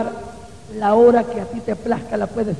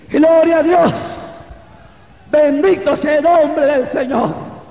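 loud, high shout of praise, then slower blessing; alabanza exaltada; 4 semitones higher, 4 dB louder, slower than usual. Real, not acted. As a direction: cry out praise, stretching the words. Preaching at full force. (alabanza a viva voz, alargada)

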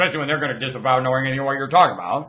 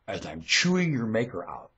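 normal talking voice

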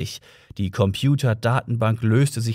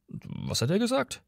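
hushed question, rising high; puzzled whisper; 8 semitones higher, 9 dB quieter than usual. A narrator's reading. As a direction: ask softly, in wonder. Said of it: "flüsterte Ford verwundert".

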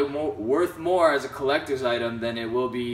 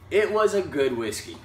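good leaned on, a little higher; weight the word "good" as if it is only just good enough; stress on good; 4 semitones higher than usual. Real, not acted. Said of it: "I emphasize the word good".

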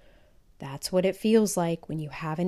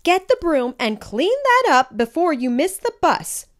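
louder, higher stern scolding; scolding mom; 9 semitones higher, 6 dB louder than usual. A narrator's reading. Mom scolding Felix to clean up, stern and loud. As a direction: order him to clean up like a cross mother. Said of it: "scolded Mom".

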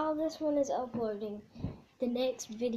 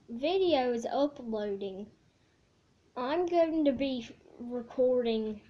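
speaking around fingers; fingers in mouth. Real, not acted. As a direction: hand over mouth. Seen 0: hand covers mouth, fingers in mouth throughout; no food seen.

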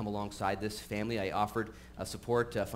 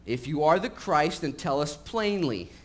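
impatient demand; 5 semitones higher, 6 dB louder, slower than usual. Acted. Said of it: "And they said to him".

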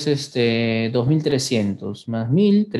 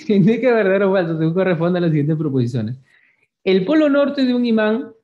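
very fast, wired reading; too much caffeine; 4 semitones higher, faster than usual. Real, not acted. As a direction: race through it over-caffeinated. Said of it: "que tomé mucho café".

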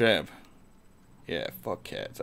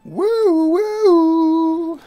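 high loud whooping; 10 dB louder than usual. Real, not acted. Whooping at the game, very high and loud. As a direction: let out a cheer.

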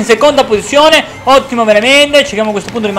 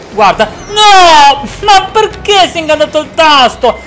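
much higher, louder disbelief; dismayed outcry; 9 semitones higher, 5 dB louder than usual. Real, not acted. Gamer whose button stuck, shocked outcry. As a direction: cry out in dismay.

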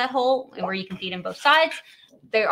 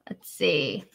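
muttered whisper; 5 semitones lower than usual. Real, not acted. Scanning the chat.

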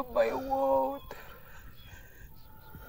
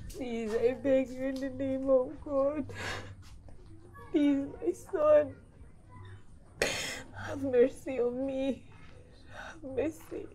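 weeping plea; 4 semitones lower, 4 dB quieter than usual. Acted. Mother begs son, weeping.

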